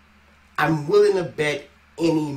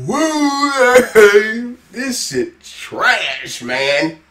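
mock hype; 6 semitones higher, 9 dB louder, much slower than usual. Real, not acted. Reacting after the song ends.

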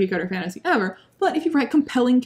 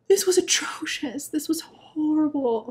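whispered verdict; 6 semitones higher than usual. Real, not acted.

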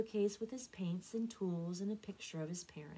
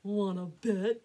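loud, menacing taunt; thundering reply; 9 dB louder, slower than usual. A narrator's reading. Drake to Elmer, loud menacing. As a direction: threaten from ambush. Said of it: "the thundering reply".

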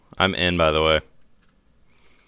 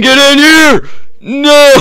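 loud cry; 11 dB louder, slower than usual. Real, not acted. Death in game, loud cry.

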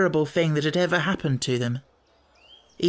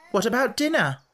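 sudden question; 9 semitones higher than usual. A narrator's reading. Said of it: "said Robert suddenly".

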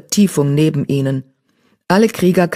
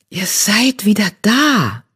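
slow, hushed amazement; barely believing her eyes; wondering; 5 semitones higher, slower than usual. A narrator's reading. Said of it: "sagte Sandsturm verwundert". (verwundert, langsam)

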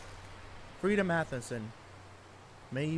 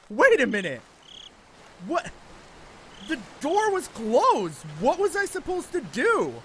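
frustrated outcry; 9 semitones higher, 4 dB louder than usual. Real, not acted. Protesting death in game.

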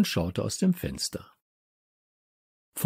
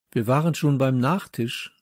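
ordinary delivery

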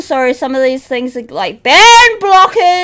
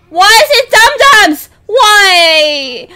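whiny despair; 4 semitones higher, 4 dB louder, slower than usual. Real, not acted. Jumpscared, despairing.

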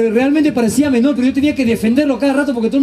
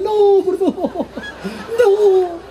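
high pleading cries; mock terrified sweaters begging; screaming sweaters; 7 semitones higher, much slower than usual. (chompas gritando de miedo)

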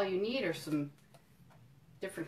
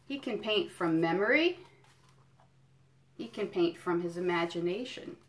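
put-on reading to children; 3 semitones higher than usual. Museum educator reading a picture book to kids, storytelling. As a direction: savour each item for little listeners.

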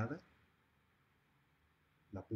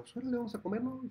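mock cheerful; faster than usual. Invited brother reacts.

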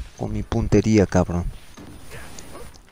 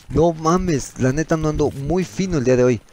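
usual speaking voice

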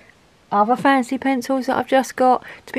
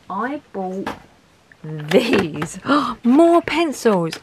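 excited; 2 semitones higher, much slower than usual. Real, not acted. Guilty pencil reveal.

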